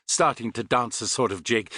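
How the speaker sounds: plain reading voice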